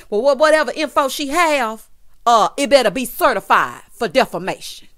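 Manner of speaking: loud mock Southern twang; thick Southern twang, belted out. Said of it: "why did they give us this Southern twang?".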